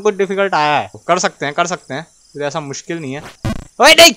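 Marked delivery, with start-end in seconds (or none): 3.8-4.1 high, loud cry of dismay; real frustrated shout; dismayed yell. Real, not acted. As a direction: shout it as you drop. Falls in the game.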